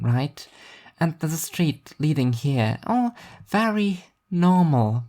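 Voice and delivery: Alice's voice. A narrator's reading. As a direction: lightly lift into Alice, reasoning.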